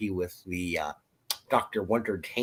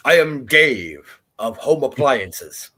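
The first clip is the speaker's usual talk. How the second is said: slow, louder ceremonial self-introduction; 3 semitones higher, 5 dB louder, slower than usual. Pretend. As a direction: announce yourself as a store-tribe leader. Playing a store tribe chief.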